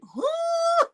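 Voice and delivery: high squeaky baffled huh; a shocked, confused huh; mock stunned huh. D's baffled reaction in chat.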